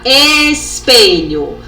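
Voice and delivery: "espelho" very slow, first syllable stretched; sounded-out word. Shown as a demonstration. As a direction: draw out the opening "es" of "espelho". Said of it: "começam com o mesmo som".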